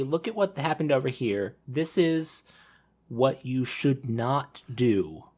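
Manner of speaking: usual speaking voice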